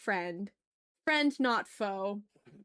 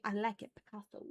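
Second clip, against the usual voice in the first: softer repeat of a meme catchphrase; meme quote; 6 dB quieter than usual. Retold or imitated. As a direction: quote the meme quietly, like a vocal stim. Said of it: "I love that meme".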